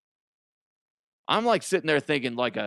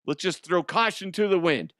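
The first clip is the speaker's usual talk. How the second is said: ordinary delivery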